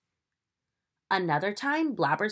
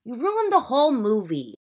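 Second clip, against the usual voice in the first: annoyed; 6 semitones higher, 8 dB louder than usual. Acted. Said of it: "howled Joan and George".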